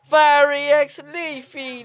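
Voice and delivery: slow, drawn-out cheer for the pairing; excited cheer. Real, not acted. Viewer cheering on Fiery and Leafy, excited and drawn out. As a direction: stretch out each name with excitement.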